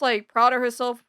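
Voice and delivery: ordinary talk, nothing marked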